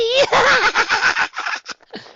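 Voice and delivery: loud, high delighted laugh; high squealing laugh. Real, not acted. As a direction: burst into shrieking laughter. Loud laugh after outburst.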